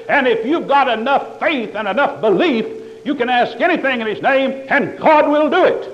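loud, high revival preaching building up; fiery preaching. Acted. Preacher parodying prosperity gospel to his congregation, fiery. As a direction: rise into a full revival cry.

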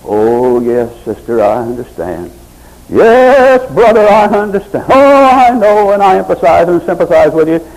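mock the soothing pastor; hammed-up sweet-talking pastor; calm sweet pastor. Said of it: "nice pastor there with a calm, sweet voice".

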